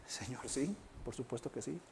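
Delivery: very soft, low reply; hushed contrite answer. Acted. Peter answers Jesus asking if he loves him, hushed and humble. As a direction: answer almost under the breath, humbly.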